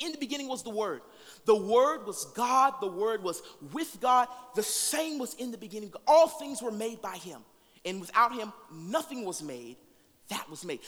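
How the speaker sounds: rising, loud scripture proclamation; preaching scripture. Acted. Preacher proclaiming John's opening verse to the church, full force. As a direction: proclaim the gospel's opening to the congregation.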